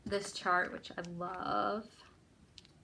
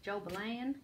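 JOBELAN drawled in a country accent, rising at the end; country twang stretching the last syllable of Jobelan; 2 semitones higher than usual. Shown as a demonstration. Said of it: "with a country accent".

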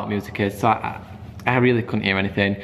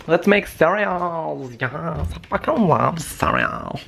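slow, higher goofy voice; silly voice; 5 semitones higher, slower than usual. Pretend. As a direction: ham it up, drawn out. Goofy voice over making cereal.

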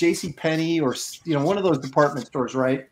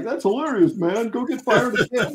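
egging-on friends; 6 semitones higher than usual. Retold or imitated. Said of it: "because we'd all like".

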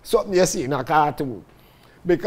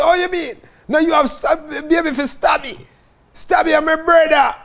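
excited explanation; 10 semitones higher, 4 dB louder than usual. Retold or imitated.

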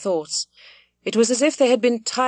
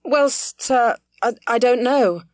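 hesitating; 5 semitones higher, 4 dB louder, slower than usual. A narrator's reading.

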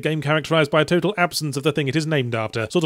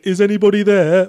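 raised, louder haunted-house call; higher, louder searching call; disembodied voice; 5 semitones higher, 5 dB louder than usual. Acted. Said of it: "a disembodied voice occasionally going".

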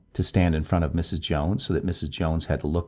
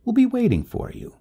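high, louder urgent promise; 5 dB louder than usual. A narrator's reading. Neighbor promises help before leaving, urgently. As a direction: urgently press the offer of help. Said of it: "turning and saying urgently".

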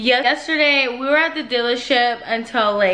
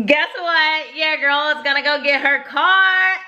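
high-pitched, gleeful reveal rising to a squeal; excited announcement; 5 semitones higher than usual. Real, not acted. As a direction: share big news, bursting with excitement. Announcing she is getting the car.

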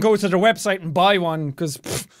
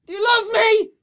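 calling out; 10 semitones higher than usual. Pretend. Asking mom from afar.

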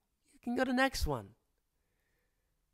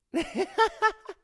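teasing laugh; 6 dB louder than usual. Acted. Teasing partner who turned away.

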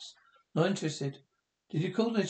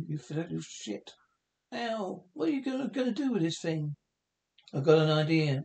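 usual speaking voice

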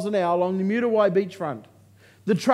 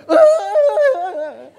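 very high, loud laughing mock shriek; 9 dB louder than usual. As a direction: squeal in mock shock. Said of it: "When they do, I go".

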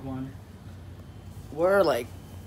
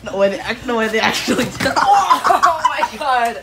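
laughing in disbelief; 5 semitones higher, 10 dB louder than usual. Real, not acted. Friends reacting to a surprise.

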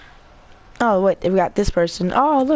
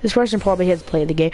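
ordinary talk, nothing marked

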